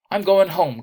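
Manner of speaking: high, loud and quavering. A narrator's reading. Miss Bowles quavering that she's leaving the tense room, loud and high. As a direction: let the voice tremble as she bolts. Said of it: "quavered Miss Bowles".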